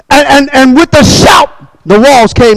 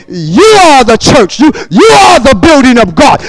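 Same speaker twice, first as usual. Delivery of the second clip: ordinary talk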